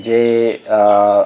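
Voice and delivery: normal talking voice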